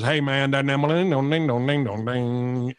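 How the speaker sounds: mock Southern twang, words turning into nonsense; Jim Ross. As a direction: parody a Southern accent sliding into sing-song gibberish. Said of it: "they just hear Jim Ross is".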